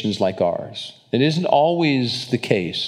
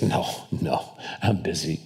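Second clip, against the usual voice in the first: whispered mock brush-off; breathy dismissive no, no; 7 semitones lower, 7 dB quieter than usual. Friend turning down church invite, whispered brush-off.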